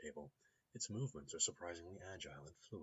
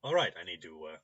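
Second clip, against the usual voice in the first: ordinary talk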